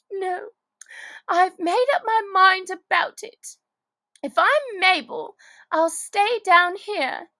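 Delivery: put-on a touch of a girl digging in her heels; lightly voiced Alice.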